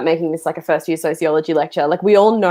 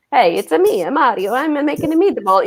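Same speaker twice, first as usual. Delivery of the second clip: hammed-up Italian accent, pitched higher; Mario; 7 semitones higher than usual. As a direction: parody a cartoon Italian accent. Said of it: "it never became a parody".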